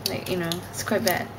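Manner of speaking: ordinary talk, nothing marked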